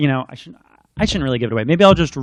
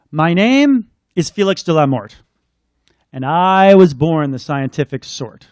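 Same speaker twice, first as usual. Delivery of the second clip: lilting rhyming read-aloud; storybook reading; 5 dB louder, slower than usual. Acted. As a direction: bring the picture book to life. Continues picture book reading.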